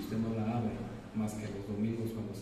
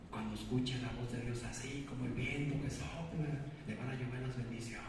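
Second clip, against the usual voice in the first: imitating soft-voiced charismatics; 11 dB quieter than usual. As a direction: speak softly like wind blowing, mimicking them. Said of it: "como por ahí le dicen algunos carismáticos". (imitando a carismáticos, voz suave como el viento)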